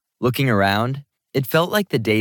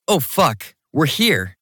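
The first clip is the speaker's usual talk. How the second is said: higher, startled exclamation; sudden "oh no" exclamation; exclaiming; 6 semitones higher, slower than usual. A narrator's reading. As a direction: blurt it out as a sudden realization. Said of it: "I exclaimed".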